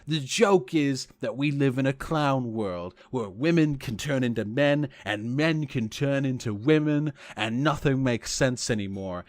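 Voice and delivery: lightly voiced smug pundit; slow mock pundit lecture; Paul Joseph Watson impression. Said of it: "he's gonna say".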